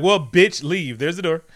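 loud, indignant acted retort; mock angry dismissal. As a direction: throw them out in a hammed-up quarrel. Acted quarrel, throwing her out.

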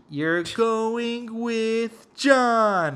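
high, slow, drawn-out group cheer; cheesy family-movie chorus. Retold or imitated. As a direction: draw it out like a corny film family. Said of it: "a cheesy-ass family movie".

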